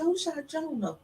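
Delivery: a hint of a small child, high and soft; child asking. Son asks mama about the shooting, soft child voice. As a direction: ask it softly like a little boy. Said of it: "he said".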